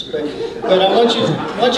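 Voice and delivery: talking through leftover laughter; laughing. Real, not acted. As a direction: get back to the point while still laughing. Laughing while resuming the point.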